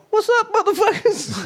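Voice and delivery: high-pitched hollered mock greeting; hammed-up greeting. Imagined Coney showing up in Oakland, hammed-up greeting. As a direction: call it out high, laughing through it. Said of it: "and he's just like".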